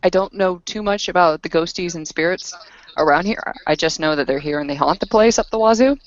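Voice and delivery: usual speaking voice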